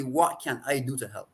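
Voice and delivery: usual speaking voice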